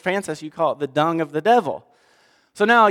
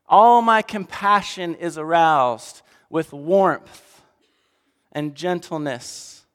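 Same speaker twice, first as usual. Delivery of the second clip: preacher voicing God, gentle to grand; building scripture reading; 2 semitones higher than usual. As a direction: let compassion swell into a lion's roar. Reads God's words in Hosea.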